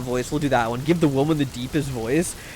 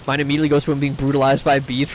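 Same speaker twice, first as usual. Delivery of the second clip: ordinary delivery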